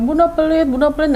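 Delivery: retold kid's mild mock yell; mock child whining yell. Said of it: "bahkan teriak-teriak".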